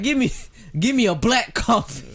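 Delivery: hammed-up high-pitched coffee demand; imitating Chris Rock. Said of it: "He's like".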